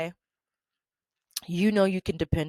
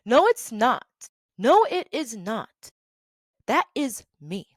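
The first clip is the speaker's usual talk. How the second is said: higher, firm, acted indignation; indignant rebuttal; 4 semitones higher, 4 dB louder than usual. Employee denying the team deserves credit, indignant. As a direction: push back hard, each denial raised.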